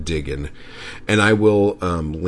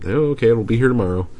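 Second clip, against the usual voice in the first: quick, higher and louder mock ease; rapid, offhand acted reply; breezy online shopper; 5 semitones higher, 5 dB louder, much faster than usual. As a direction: toss it off like an instant, effortless order. Acts out a quick online order.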